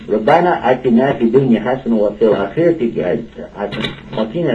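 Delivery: fast, loud, fluent recitation of supplication; rapid Arabic prayer. Real, not acted. Radio host closing the show with a fast prayer. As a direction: rattle off the prayer with fervour.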